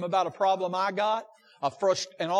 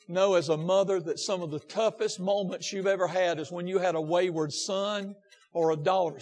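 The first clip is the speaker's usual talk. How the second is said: ordinary talk, nothing marked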